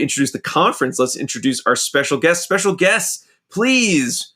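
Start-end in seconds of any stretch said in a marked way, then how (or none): 2.4-4.3 rising, showy game show call; old TV show host. Shown as a demonstration. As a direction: announce it like a retro TV host. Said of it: "That's like an old T V show thing".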